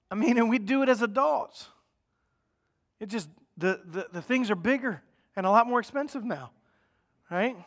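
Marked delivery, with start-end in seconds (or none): none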